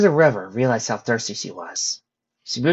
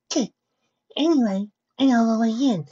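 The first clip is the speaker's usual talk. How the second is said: Fluttershy; 12 semitones higher than usual. Acted. Fluttershy telling friends she knows the way, high voice. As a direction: keep it light and high.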